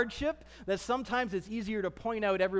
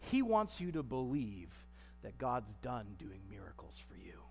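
much lower and softer, almost under the breath; low hush; 13 semitones lower, 12 dB quieter than usual. Real, not acted. Pastor exposing the enemy's lie, sinking to a hush. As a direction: let it drop to near nothing.

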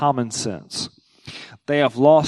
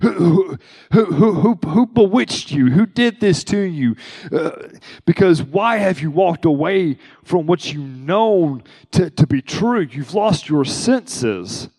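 high, pressing scold that slows at the end; pleading rebuke, pitched up and stretched; exasperated Paul; 4 semitones higher than usual. Retold or imitated. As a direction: scold them like a frustrated apostle. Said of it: "he's writing 'cause he's angry".

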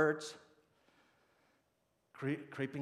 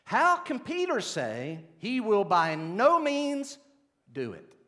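If the Lord of the universe dragged out slowly, louder, words stretched; slow emphatic question; 8 semitones higher, 10 dB louder, slower than usual. Retold or imitated. Preacher reading a member's question to the church, slow and emphatic. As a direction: lean on every word of the question, slow and deliberate. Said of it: "Custer asked, quote".

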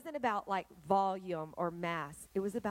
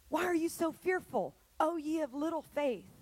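rebuking Jesus; 6 semitones higher than usual. Acted.

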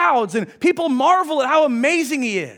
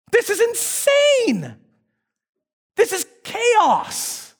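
very slow and high, stretching every word; slow marveling emphasis; drawn-out emphasis; 8 semitones higher, much slower than usual. Real, not acted. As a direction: lean on it slowly for effect. Preacher stressing the chaos.

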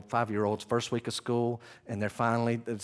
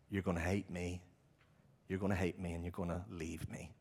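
low, very soft voice; acted hushed grief, with pauses; quiet sorrow; 4 semitones lower, 9 dB quieter than usual. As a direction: say it hushed, sinking away at the end. Voices Jesus's sorrow before the cross.

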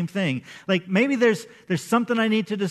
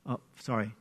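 ordinary talk, nothing marked

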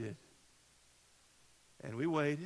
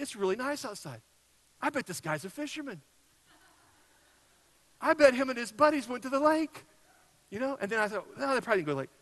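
rising higher and louder with each guess, working himself up; worked-up rant; 9 semitones higher, 9 dB louder, faster than usual. Retold or imitated. Pastor retelling his spiral of suspicion to the congregation, frantic and rising. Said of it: "I just started in and going on".

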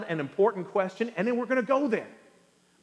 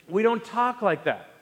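usual speaking voice

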